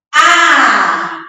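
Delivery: "A" loud and long; initial letter sounded. Shown as a demonstration. Teacher pointing out the initial letter, "A" loud and sustained. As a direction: hold "A" as one strong open vowel. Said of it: "Ó a letra inicial".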